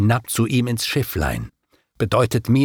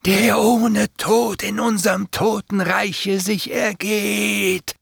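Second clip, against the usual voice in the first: much higher, slow menacing voice; hissing demons; 11 semitones higher, slower than usual. A narrator's reading. Demons at the gate scorning the living man, high and slow. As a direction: sneer it slowly, high and eerie.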